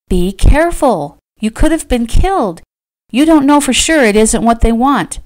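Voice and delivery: high, loud worried reproach; urgent scolding. A narrator's reading. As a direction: plead and scold at once. Frightened woman berates him.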